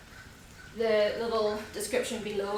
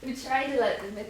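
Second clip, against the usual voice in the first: ordinary delivery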